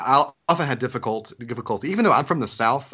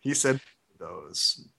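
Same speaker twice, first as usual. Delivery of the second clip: deadpan correction; 4 semitones lower, 6 dB quieter, much slower than usual. Retold or imitated. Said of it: "The correction ran something like this".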